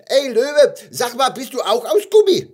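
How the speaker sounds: high, loud little-creature voice; calling little gummy bear. Acted. Little gummy bear calls to a lion, high cheeky call. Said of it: "Einem Löwen rief es zu".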